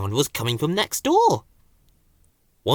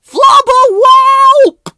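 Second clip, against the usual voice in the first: very high, very loud yelp; calling for help; 15 dB louder, slower than usual. A narrator's reading. Ben stuck upside down calling Bill, loud high yelp. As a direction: cry out from the drain. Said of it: "he called".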